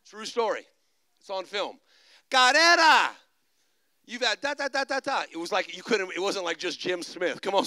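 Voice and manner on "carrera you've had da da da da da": loud calling out to the back of a tent; calling out a name. Retold or imitated. Preacher calls a name to seven thousand people, loud call. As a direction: ring out the name to a big crowd.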